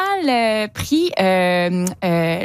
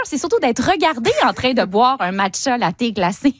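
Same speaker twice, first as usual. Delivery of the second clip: usual speaking voice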